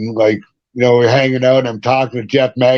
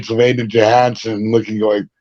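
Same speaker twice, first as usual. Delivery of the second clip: ordinary talk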